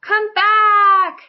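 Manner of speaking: high, loud desperate call. Acted. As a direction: shout across the lake. Rocky calls after distant friends.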